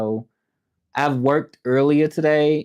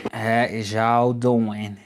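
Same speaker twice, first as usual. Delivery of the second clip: slow drawn-out greeting; much slower than usual. Shown as a demonstration. Greeting live viewers, slow and stretched. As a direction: stretch each word out slowly.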